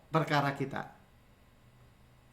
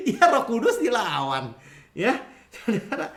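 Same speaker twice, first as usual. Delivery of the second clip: amused, higher voice breaking with laughter; laughing; 5 semitones higher than usual. Real, not acted. Preacher to congregation about defying the Holy Spirit, laughing. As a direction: chuckle through the whole line.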